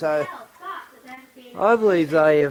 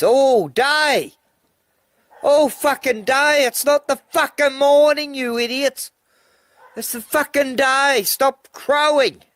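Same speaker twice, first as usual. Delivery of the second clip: angry rant; 7 semitones higher than usual. Real, not acted. Man berating roosters that keep crowing, irritated rant. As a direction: tell them off, exasperated.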